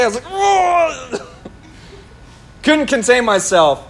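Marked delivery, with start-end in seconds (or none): 0.2-1.3 long high strained "whoa" wail; high drawn-out howl of exasperation; pent-up frustrated cry. Retold or imitated. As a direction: let the bottled-up frustration burst out, held back from full volume. Retells bottled-up outburst to wife.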